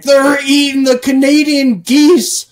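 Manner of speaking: high, loud panicked yokel cry; hammed-up outraged shout; mock hillbilly shout. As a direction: shout it like a scandalised local. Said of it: "And they're like".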